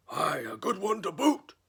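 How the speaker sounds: loud, high chorus of pirates; pirate crew agreeing. Acted. Crew agrees with their captain, rowdy cheer. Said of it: "the others agreed".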